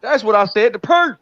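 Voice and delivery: hammed-up country twang calling Tank. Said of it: "you sound like a redneck".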